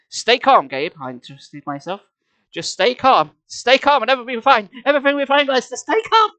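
frantic self-reassurance. Acted. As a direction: talk yourself down but climb into panic.

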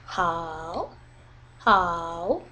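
"Hao" sinking low, then climbing; dipping tone. Shown as a demonstration. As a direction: drag "Hao" down and sweep it up. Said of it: "and then it goes up".

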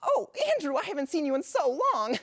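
put-on high, squealing surprise at an old friend; delighted greeting. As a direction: gush at a long-lost flame. Runs into old college flame.

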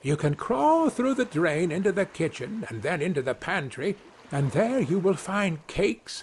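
high, lilting little man's voice; high, enticing storybook voice; little Tom tempting. Acted. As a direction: list the treats temptingly, pitched high.

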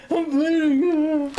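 slow, high, loud whiny voice; hammed-up pitiful whine; mock whine. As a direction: drag out the complaint slowly. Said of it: "I'd be like".

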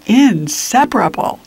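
usual speaking voice

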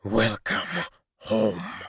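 slow, halting, thick-tongued creature; thick halting wolf voice. A narrator's reading. Crafted wolf greets the fevered boy, slow and halting. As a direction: drag each word out like a clumsy beast. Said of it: "spoke in a thick, halting voice".

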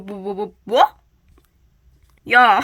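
ordinary talk

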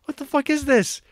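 very high-pitched incredulous squeal; baffled outrage. Retold or imitated. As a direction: react like a bewildered, appalled viewer. Acts out baffled viewer reaction.

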